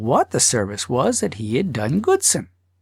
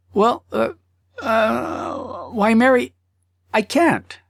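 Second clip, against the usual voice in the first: higher, stammering husband voice; hesitant husband; 9 semitones higher, much slower than usual. Acted. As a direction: fumble for words, stalling. Husband stammering under questioning.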